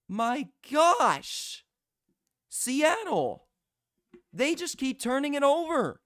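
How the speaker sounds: high, loud, disbelieving play-by-play; stunned excitement. Real, not acted. Commentator yelling in disbelief as Seattle fumbles again. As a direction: shout it like you can't believe the fumbles.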